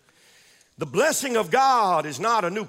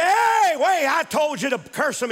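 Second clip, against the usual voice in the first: indignant protest; 4 semitones higher than usual. Acted. Balak objecting to the prophet's blessing, indignant shout. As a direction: call out to stop him mid-act.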